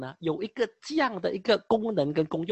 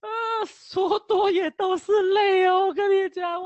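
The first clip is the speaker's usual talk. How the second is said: mock wail; 9 semitones higher, 7 dB louder than usual. Lamenting the mental hospital days.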